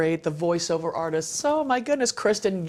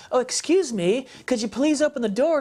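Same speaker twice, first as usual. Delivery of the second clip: prim, higher polite voice; mock polite knocker; 6 semitones higher than usual. Preacher mocking a timid, polite knock at the door. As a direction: play a timid overly polite visitor. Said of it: "not a little polite little tap".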